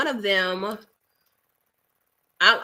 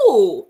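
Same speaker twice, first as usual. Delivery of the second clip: enthusiastic ooh; 7 dB louder than usual. Real, not acted. Reacts to a movie she loved.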